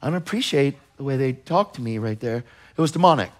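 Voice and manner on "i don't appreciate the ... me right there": mock offended mumble, much softer; hesitant, hurt and quiet; a muttering half-hearted complaint; mumbling offended person. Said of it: "We're saying".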